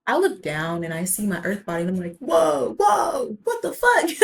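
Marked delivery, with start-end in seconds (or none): 2.2-4.1 high, loud panicked cry of shock; panicked shout. Retold or imitated. Seeing her own body from high above, a loud panicked cry. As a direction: yelp it out, suddenly terrified. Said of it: "I was panicking".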